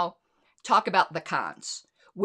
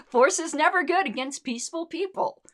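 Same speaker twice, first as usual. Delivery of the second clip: laughing; 9 semitones higher, 4 dB louder than usual. Real, not acted. Still laughing after outburst.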